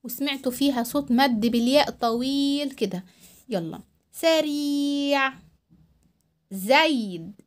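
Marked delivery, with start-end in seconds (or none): 3.5-5.3 سريع with the ee held long; slow dictation voice; slow stretched read-aloud. Shown as a demonstration. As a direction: drag out the ee. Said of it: "أنا هقرأهم".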